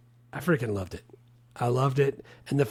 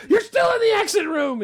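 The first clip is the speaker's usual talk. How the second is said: much higher, loud angry shout; storming out yell; 5 dB louder than usual. Retold or imitated. Roy storms out of the therapist's office, shouting. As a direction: hurl it at her as you storm out. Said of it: "when he storms out at the end".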